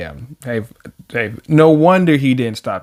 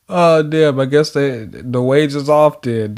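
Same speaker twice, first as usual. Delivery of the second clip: ordinary talk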